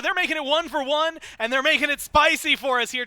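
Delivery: high, loud, excited shoutcast; play-by-play. Real, not acted. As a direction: shout the fight as it unfolds. Live play-by-play at a fight.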